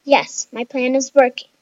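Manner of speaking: ordinary delivery, nothing marked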